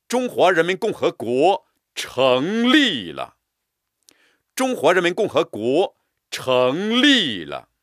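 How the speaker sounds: 成立了 rung out loud and slow; proud stressed proclamation. Shown as a demonstration. Teacher proclaiming, stress on 成立了. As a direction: announce it, leaning on 成立了. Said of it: "加重力度和音强".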